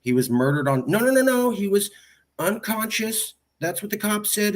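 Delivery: retold insistence. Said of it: "And I go".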